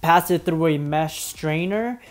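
soft, quiet tutorial voiceover; calm voiceover narration. Acted. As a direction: narrate the steps calmly and quietly.

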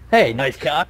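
normal talking voice

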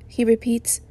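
ordinary delivery

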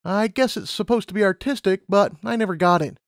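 put-on high, eager young mare; Lumina's voice. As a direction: voice her up high, keen and bright-eyed. Lumina chatting.

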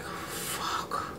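hushed, voiceless oh-no; dreading whisper. Retold or imitated. Daughter waking to dad's banging, dreading whisper. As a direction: breathe it out under your breath. Dread as father bangs on door.

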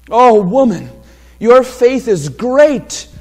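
a heartfelt, stretched-out Oh woman; emotional. Acted. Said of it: "notice the emotion here".

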